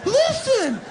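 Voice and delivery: very high, loud falsetto pleading; squeaky clingy falsetto begging; mock needy partner. Clingy partner begging as he leaves home, high falsetto.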